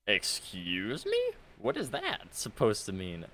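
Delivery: slow, incredulous, offended; offended disbelief. Real, not acted. Streamer insulted by an opponent, slow offended disbelief. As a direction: say it like you just got insulted.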